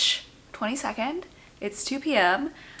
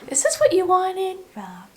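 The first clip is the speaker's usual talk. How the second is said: high, sing-song cooing at the puppy; 9 semitones higher than usual. Real, not acted. Cooing at the dog.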